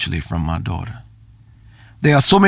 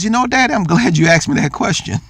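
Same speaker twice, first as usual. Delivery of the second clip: daughter's voice; 9 semitones higher than usual. Retold or imitated. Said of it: "She says".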